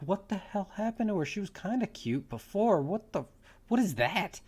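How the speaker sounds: high-pitched, incredulous outburst; aghast, baffled protest; appalled disbelief. Retold or imitated. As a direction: react in mock horror, pitch jumping up. Acting out his shocked reaction.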